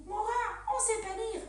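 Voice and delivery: high, worried little voice; scared child. Retold or imitated. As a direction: imitate a frightened pupil. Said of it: "ils ont eu un peu peur".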